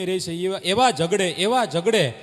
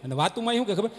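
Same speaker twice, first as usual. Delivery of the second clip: normal talking voice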